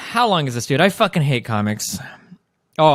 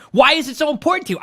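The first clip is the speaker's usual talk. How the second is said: ordinary delivery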